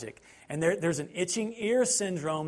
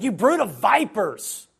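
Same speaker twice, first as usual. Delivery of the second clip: slow, louder put-down; retold rebuke; 3 semitones higher, 7 dB louder than usual. Jesus answering the Pharisees, slow and louder. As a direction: spit it back at them.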